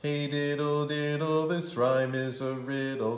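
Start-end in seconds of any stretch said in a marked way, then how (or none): none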